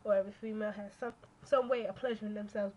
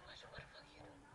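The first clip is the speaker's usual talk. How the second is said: whispered mock question; retold nosy question, whispered; 18 dB quieter, faster than usual. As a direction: breathe it out, no voice. Retelling chat asking again.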